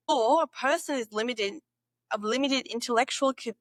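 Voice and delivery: usual speaking voice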